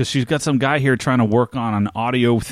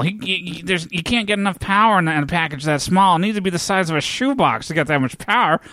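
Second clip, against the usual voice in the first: a lightly raised, fussy computer nerd; nerdy Dexter voice; 6 semitones higher than usual. Retold or imitated. Lightly voiced nerd explaining to the bar crowd. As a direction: play the nervous nerd, a little higher.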